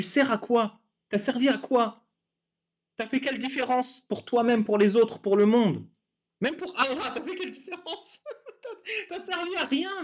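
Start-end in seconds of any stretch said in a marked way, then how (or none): 6.4-10.0 words breaking up into laughter; can't keep a straight face, higher and softer; real giggle through the question; laughing. Real, not acted. Laughs at own point.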